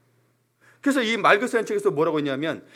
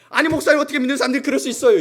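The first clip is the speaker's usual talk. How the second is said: higher, louder and fast, indignant; angry complaint; 6 semitones higher, 5 dB louder, faster than usual. Retold or imitated. Hurt church member complaining to the pastor, fast indignant outburst. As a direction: protest hotly to the pastor. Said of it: "화를 냅니다".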